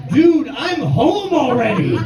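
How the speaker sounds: exasperated retort. Retold or imitated. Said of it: "exasperated reply".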